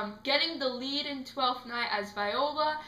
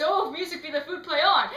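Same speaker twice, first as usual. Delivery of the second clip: quicker mock stage declaiming; theatrical recitation; 4 semitones higher, 5 dB louder, faster than usual. Actor rehearsing among unprepared castmates, hurried recitation. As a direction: rattle off the verse, louder on the last words.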